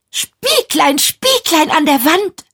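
put-on high, girlish storybook voice; a vain girl calling on her mirror; high sing-song plea to the mirror; Jennifer's voice. A narrator's reading. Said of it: "sagte Jennifer".

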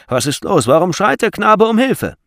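loud, high startled question; agitated shouted question; alarmed onlooker. A narrator's reading. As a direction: call out over a gathering crowd. Alarmed onlookers ask what happened.

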